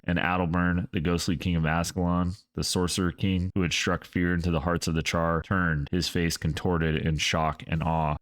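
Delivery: ordinary delivery, nothing marked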